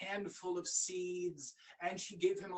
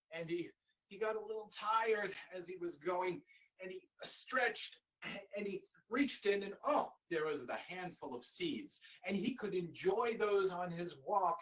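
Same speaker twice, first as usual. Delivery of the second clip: ordinary delivery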